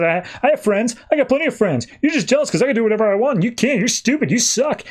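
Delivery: high, rushed mock-petulant outburst; childish retort. Acted childish outburst in quarrel.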